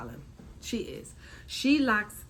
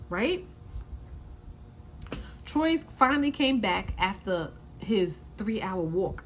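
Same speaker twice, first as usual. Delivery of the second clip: usual speaking voice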